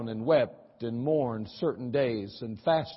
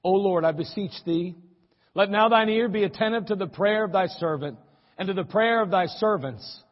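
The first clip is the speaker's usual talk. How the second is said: fervent scripture prayer; 3 semitones higher, 4 dB louder than usual. Acted. Pastor reading Nehemiah's prayer to the church, impassioned. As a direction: plead the prayer with fervour.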